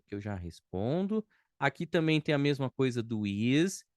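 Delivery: usual speaking voice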